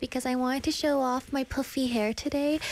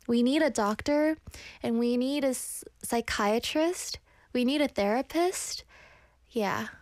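ordinary talk, nothing marked

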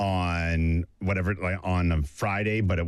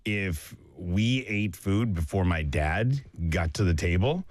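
ordinary talk

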